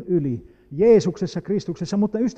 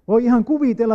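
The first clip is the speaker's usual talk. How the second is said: normal talking voice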